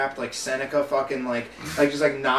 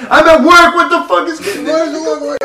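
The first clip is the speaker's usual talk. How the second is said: much higher, louder, slowed yell back; mock yelled reply; 11 semitones higher, 13 dB louder, slower than usual. Girlfriend yelling back at her boyfriend, mock yell. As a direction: shout back in disbelief. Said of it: "she'd just be like".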